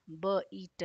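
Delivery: ordinary talk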